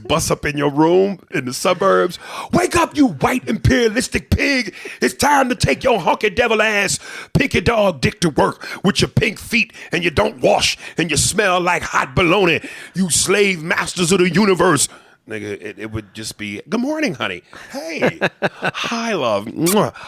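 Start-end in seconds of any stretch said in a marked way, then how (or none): 2.5-14.9 shouted high mock preacher rant, bursting in; pretend street preacher wake-up. Israelites as alarm clock. 16.7-19.9 high, lightly sweet mock spouse voice; mock sweet greeting.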